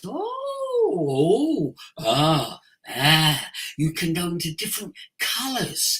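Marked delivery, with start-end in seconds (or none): 0.0-3.5 oh jumping high then ahs dropping low; sliding vocal sighs, high to low. Shown as a demonstration. Voice coach showing range on open vowels. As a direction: sweep the voice from top to bottom on open vowels. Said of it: "take your voice up to different areas".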